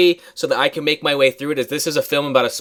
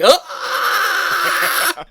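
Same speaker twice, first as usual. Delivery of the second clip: mock pod-person scream. Friend acts the father screaming in a post-credit scene. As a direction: scream with hand raised.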